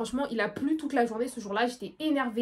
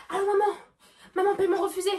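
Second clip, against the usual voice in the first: whiny call to mom; 8 semitones higher, 3 dB louder than usual. Retold or imitated.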